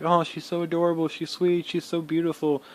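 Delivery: put-on high, gushing praise of the girlfriend; gushing mom. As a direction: imitate mom cooing over her.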